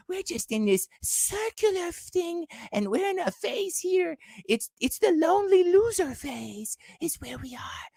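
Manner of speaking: high, thin sneering mimicry, dropping to a whisper; mocking high voice. Ranting at his audience, mimicking them in a high mocking voice. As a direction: play a whiny loser repeating it's circular. Mocking people who call it circular.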